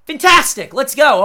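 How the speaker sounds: sarcastic cheer. Real, not acted. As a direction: cheer it big, then let the catch land. Sarcastic cheer over bad timing.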